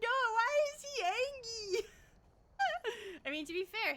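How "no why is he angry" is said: put-on baby talk. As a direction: coo at him like a pet. Cooing at character.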